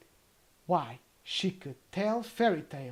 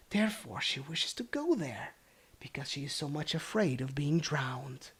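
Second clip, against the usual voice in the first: whispered. Acted. Youngest sister whispers a secret into the goblin's ear. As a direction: breathe it secretly. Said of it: "whispered into the old man's ear".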